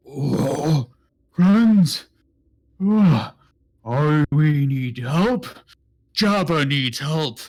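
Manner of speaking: loud, slow creature voice; pleading two-headed giant. Pretend. Giant begs the adventurers for help, loud lumbering plea. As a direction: bellow a drawn-out plea for help. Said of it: "and goes".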